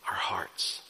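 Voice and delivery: solemn hushed repeat; hushed emphasis. Real, not acted. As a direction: drop to a hush to let it land.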